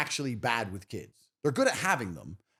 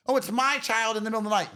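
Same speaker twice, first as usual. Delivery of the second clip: mocking wife; 8 semitones higher, faster than usual. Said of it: "Excuse me, love".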